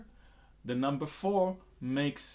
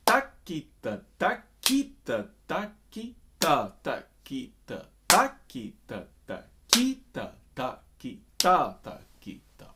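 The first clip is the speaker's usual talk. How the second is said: takita recited slower, the stress moving from TA to KI to final TA; measured takita count with a travelling accent; takita junction points; 5 dB louder, slower than usual. Shown as a demonstration. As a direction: shift the punch through the syllables of "takita". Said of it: "notice the junction points".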